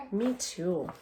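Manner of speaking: ordinary talk, nothing marked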